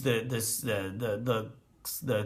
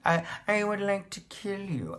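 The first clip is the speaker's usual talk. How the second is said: a light mock Doctor Evil voice, pitched higher; Doctor Evil accent; 7 semitones higher, 4 dB quieter than usual. Reviewer imitates the character's odd Doctor Evil villain line. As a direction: lightly ham up a villain's menace. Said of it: "weird Doctor Evil, uh, accent".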